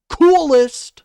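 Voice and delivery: coolest shot way up high and loud; sarcastic stress. Acted. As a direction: fling the word coolest up in mockery. Sarcastic jab at caller.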